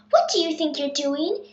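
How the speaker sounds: plain reading voice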